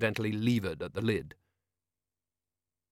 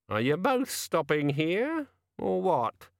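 landlady voice; 7 semitones higher, slower than usual. Acted.

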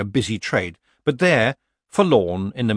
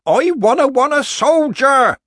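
put-on very high, loud toddler wail; screaming child tantrum; 10 dB louder than usual. A narrator's reading. Small boy refusing a rag doll, screaming tantrum. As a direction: shriek it like a thwarted small child. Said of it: "screaming mouth".

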